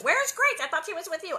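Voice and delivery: put-on high, loud frantic call for a lost child; yelling for Grace in panic; panicked shout. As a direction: call out in alarm. Panicked parents retold.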